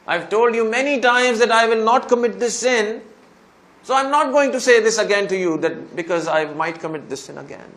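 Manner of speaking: imitating a desperate penitent's insistent prayer; retold fervent dua. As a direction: build to a loud, high confession. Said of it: "I made this dua to Allah".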